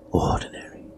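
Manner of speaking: Ordinary whispered, unvoiced and soft; whispered echo. A narrator's reading. Husband echoing the word in a stunned whisper.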